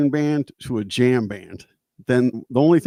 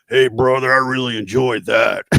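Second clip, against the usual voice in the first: a touch louder, lightly voiced burly guy; hint of a big lumberjack type's praise; big burly dude; 5 dB louder than usual. Big dude praising the guitarist after the show, lightly voiced. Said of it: "This big dude".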